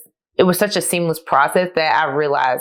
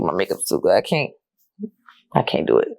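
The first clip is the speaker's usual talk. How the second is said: holding back tears; 9 semitones lower than usual. Real, not acted. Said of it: "I almost cried".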